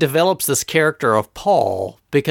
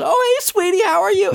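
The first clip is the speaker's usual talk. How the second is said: put-on baby-talk sweetness. [name] coos to his daughter on the phone, very high. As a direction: gush over his little daughter.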